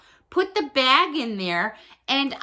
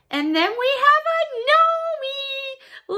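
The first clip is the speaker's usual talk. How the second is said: high, slow singsong squeal; excited reveal; 14 semitones higher than usual. Real, not acted. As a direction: draw it out in a high, delighted singsong.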